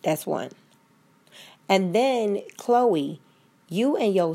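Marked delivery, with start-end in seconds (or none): none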